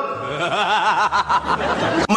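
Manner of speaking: a real burst of scornful laughter; mocking laugh. Real, not acted. Debater laughing at his rival's Arabic mistake, mocking laugh. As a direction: laugh at him, openly amused.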